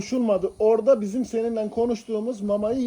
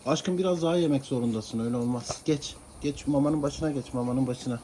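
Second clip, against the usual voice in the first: much softer, lower pleading to the cat; soft coaxing; 7 semitones lower, 8 dB quieter than usual. Real, not acted. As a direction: coax him back to his bowl gently.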